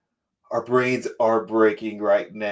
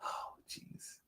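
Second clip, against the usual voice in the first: whisper; 24 dB quieter than usual. Real, not acted. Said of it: "whispered aside".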